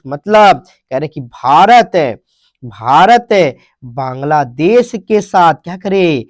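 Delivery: normal talking voice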